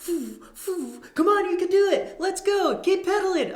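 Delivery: high, loud cheerleader voice; mock cheering. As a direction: egg him on like an overexcited fan. Cheering cyclist during triathlon.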